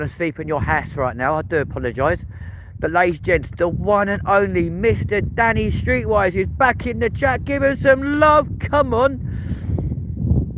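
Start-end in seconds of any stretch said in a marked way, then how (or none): 2.8-10.5 big up the guest like a showman; hype announcer. Acted. Streamer welcoming Danny into the chat, hype announcer voice.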